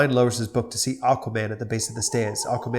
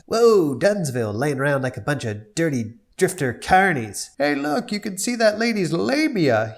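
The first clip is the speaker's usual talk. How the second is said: Quagmire voice; 6 semitones higher, 4 dB louder than usual. Acted. Quagmire voiced in read script.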